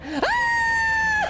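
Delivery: shrill sudden scream of fright; jump-scare shriek, way up high; startled scream. Real, not acted. Sudden text startles streamer.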